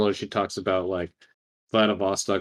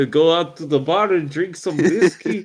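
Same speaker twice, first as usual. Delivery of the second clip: higher mock Russian accent with Southern touch; hammed-up Russian-Southern accent; more Russian than Southern; 5 semitones higher than usual. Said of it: "that was more Russian than Southern".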